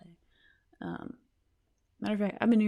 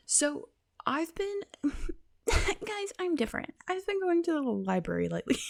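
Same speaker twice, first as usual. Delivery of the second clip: high, giggling confession; squeaky laughing admission; breaking into giggles; giggly; 9 semitones higher, 3 dB quieter than usual. Real, not acted. Giggly high-pitched confession to viewers.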